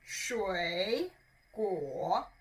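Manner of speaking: 水 and 果 each dipping low, then rising a little; low dipping tone on each syllable of 水果; third tone demo. Shown as a demonstration. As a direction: drop the pitch low on 果, then tip it up. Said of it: "It goes lower and a little bit up".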